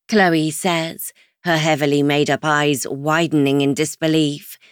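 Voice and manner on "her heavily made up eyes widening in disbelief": plain reading voice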